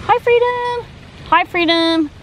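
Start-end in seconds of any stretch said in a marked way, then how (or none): 0.1-0.9 squeaky high sing-song hello; pet talk. Real, not acted. To the pet parrot, squeaky high pet-talk greeting. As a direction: greet the bird in a tiny high voice.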